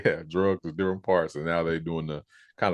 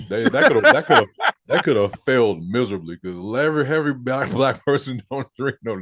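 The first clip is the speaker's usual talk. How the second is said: words broken by laughter; real laughter; laughing; 6 semitones higher, 6 dB louder than usual. Real, not acted. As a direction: laugh through the point. Laughing through commentary.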